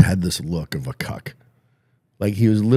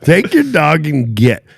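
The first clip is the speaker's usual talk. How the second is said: high, loud, drawn-out mock plea; mock pleading; 9 semitones higher, 8 dB louder, slower than usual. Husband begging the dog owner to go, mock pleading. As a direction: beg them to leave, slow and hammed-up. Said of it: "pleading with me in his eyes".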